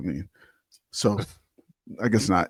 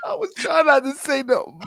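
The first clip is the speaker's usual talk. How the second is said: words bubbling through real laughter, higher; can't hold back the giggles; laughing; 14 semitones higher than usual. Real, not acted. Host still cracking up at the earlier talk-over, laughing.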